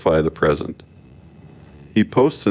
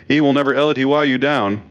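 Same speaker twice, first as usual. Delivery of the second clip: raised-pitch quote of a capitalized promise; all-caps slogan; 8 semitones higher, faster than usual. Retold or imitated. Commentator repeating a politician's stock endorsement, high all-caps voice. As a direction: lift the whole slogan well above normal pitch. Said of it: "The capitalization is the only thing working harder".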